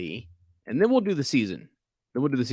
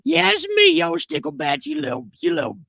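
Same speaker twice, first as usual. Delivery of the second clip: excited squeal. Acted. Greeting the caller playfully.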